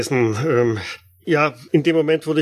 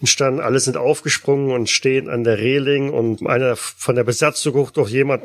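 ordinary delivery

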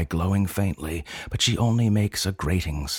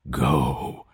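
groan; 5 semitones lower than usual. Acted. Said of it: "she groans".

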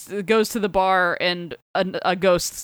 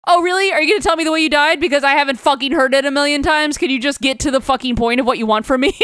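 fast, higher, louder fed-up tirade; exasperated rant; 6 semitones higher, 4 dB louder, faster than usual. Retold or imitated. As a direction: rattle it off like you've heard it a million times. Retold exasperated rant at ghost.

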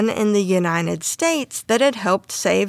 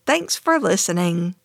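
ordinary talk, nothing marked

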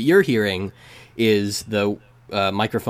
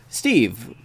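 ordinary talk, nothing marked

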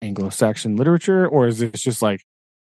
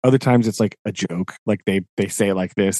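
ordinary talk, nothing marked

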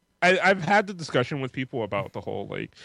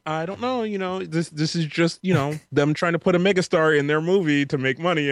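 higher-pitched voice of a hedging debater; Scott; 5 semitones higher than usual. Retold or imitated. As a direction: imitate a friend reasoning back, pitched up. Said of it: "Scott's just like".

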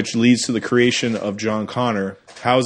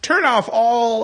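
villain shouting orders; 11 semitones higher, slower than usual. Retold or imitated. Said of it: "And he's like".

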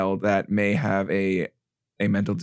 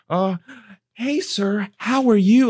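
high, sing-song talking down; overly sweet voice for a child; condescending; 10 semitones higher, slower than usual. Retold or imitated. Condescending voice to wheelchair user.

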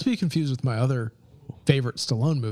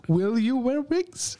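high, slow mock German accent; 9 semitones higher, slower than usual. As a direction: ham up a German interviewer. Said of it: "doing a German accent".

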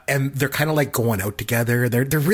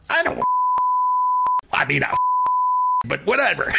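imitating the cartoon character; hormone monster voice. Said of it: "He's like".

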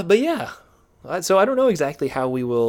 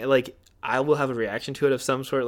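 usual speaking voice

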